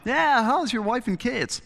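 high, slow, breezy small talk pretending nothing is wrong; lightly mock a guest's forced small talk; mock casual dinner chatter. Guests at dinner chatting as if nothing happens, high mock casual.

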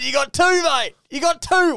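high, loud, gloating "You got two"; gleeful crowing. Retold or imitated. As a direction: crow it at him, delighted. Gleeful reply to Tim.

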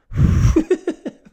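loud, breathy puff of air; blowing air. Retold or imitated. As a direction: blow hard, imitating the lads. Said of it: "blow air onto it. Just go".

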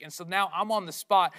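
ordinary delivery

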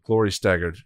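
plain reading voice

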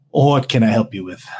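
gaunt tiefling voice. Acted. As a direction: play the gaunt blue tiefling.

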